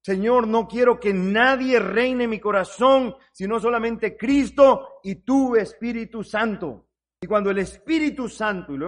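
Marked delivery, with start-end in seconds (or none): 0.0-6.9 loud, slow, emphatic model prayer; fervent prayer. Acted. Preacher showing congregation how to ask for the Spirit, fervent prayer. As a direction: plead with God at full voice. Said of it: "Tenemos que orar y decirle".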